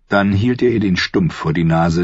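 ordinary delivery

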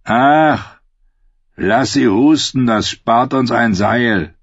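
scornful outlaw voice; callous outlaw. Acted. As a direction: wave her off callously.

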